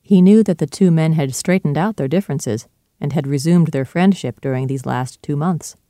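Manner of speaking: ordinary delivery, nothing marked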